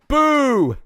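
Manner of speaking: loud, high hammed-up "Boo!"; mock booing crowd. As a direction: boo like a heckling audience. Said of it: "you going".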